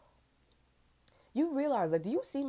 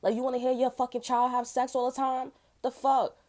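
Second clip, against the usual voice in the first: retold angry yell; 7 semitones higher, 6 dB louder than usual. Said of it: "I was like".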